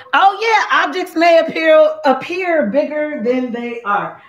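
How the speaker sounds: high-pitched, delighted recital with a laugh; gleeful aha, breaking into laughter; excited recall. Real, not acted. Recalls mirror wording, laughing.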